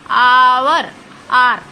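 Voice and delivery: "आवर, आर" loud, with a long opening aa; drawn out for practice. Shown as a demonstration. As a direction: stretch the first vowel of "आवर, आर". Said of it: "मैं थोड़ा धीरे से बोल रही हूँ". ("आवर, आर" में आ खींचकर ज़ोर से)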